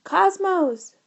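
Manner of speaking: loud, high urgent shout. A narrator's reading. George shouting for the computer, loud and high. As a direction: call the computer at full volume. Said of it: "shouted George".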